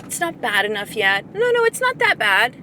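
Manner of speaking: high dismissive denial voice; mocking hesitant women. Mocking women who say it's not bad, high and breezy. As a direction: mimic clients brushing off trouble. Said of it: "they're like".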